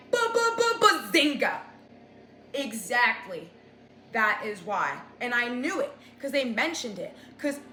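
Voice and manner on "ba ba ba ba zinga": high, loud sing-song bazinga; bazinga gotcha. Real, not acted. Telling her mom the hair was the reason, crowing loudly. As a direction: crow it like you nailed it.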